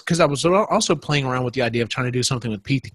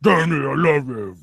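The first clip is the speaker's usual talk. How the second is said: mock Swamp Thing; 2 semitones higher, slower than usual. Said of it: "I wanna hear the Swamp Thing go".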